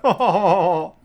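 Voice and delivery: high loud burst of laughter; a real amused laugh. Real, not acted. Laughs at the quote.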